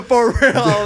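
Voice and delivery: high, laughing agreement. Real, not acted. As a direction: say it while laughing.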